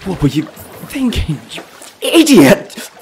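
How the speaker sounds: high, loud, slow self-berating; self-reproach. Acted. Drowning man berating himself, loud and desperate. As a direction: curse yourself in panic.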